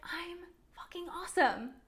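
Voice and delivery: whispered self praise, no voice. Shown as a demonstration. Whispering a swear word to spare the moms' ears. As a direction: breathe it out as a secret. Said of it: "I didn't whisper it".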